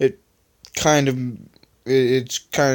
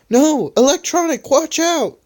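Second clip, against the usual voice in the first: higher, louder cry of warning; worried yell; 4 dB louder than usual. Acted. Rubber warning Electronic of danger, high alarmed yell. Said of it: "yelled Rubber worriedly".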